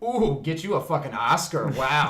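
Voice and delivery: mock-congratulate her loudly; sarcastic jab. Retelling a set story, mocking an extra loudly.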